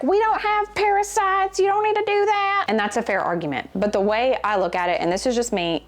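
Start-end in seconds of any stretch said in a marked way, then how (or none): 0.0-2.6 much higher put-on voice of a doubter; mocking skeptics. Mocking people who doubt her parasite cleanse in a high voice. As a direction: mimic the naysayers in a high, silly voice. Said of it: "some people are like".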